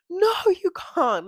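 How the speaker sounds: high, loud mock protest; hammed-up shocked objection, pitched high; mock outrage. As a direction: exclaim it like a scandalised onlooker. Acts out imagined outraged reaction.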